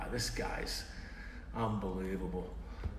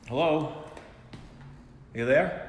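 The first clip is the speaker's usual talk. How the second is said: ordinary talk, nothing marked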